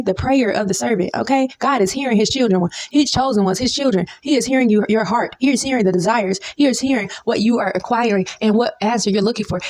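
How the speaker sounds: normal talking voice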